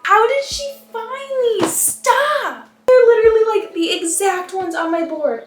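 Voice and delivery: high, loud squeal of disbelief; thrilled, pitch shooting up; astonished excitement. Real, not acted. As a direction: gush in amazement. Stunned by pieces from her board.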